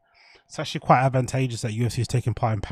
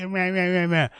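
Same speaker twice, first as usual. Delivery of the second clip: higher, sneering mocking bleats; mock them with a babyish meh meh meh; 6 semitones higher than usual. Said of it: "all the people in the chat that are saying".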